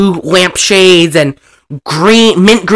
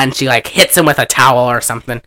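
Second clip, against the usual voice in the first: ordinary talk, nothing marked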